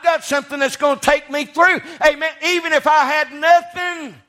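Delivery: high, loud, soaring preacher's cry; preaching at full force. Acted. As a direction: build the congregation up with a ringing shout of hope. Preaching climax on heaven.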